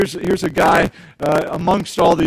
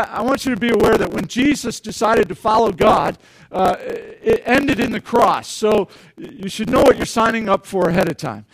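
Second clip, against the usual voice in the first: ordinary delivery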